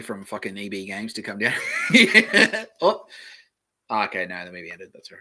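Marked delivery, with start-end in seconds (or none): 1.6-3.4 high, loud laugh. Real, not acted. Laughs at own joke.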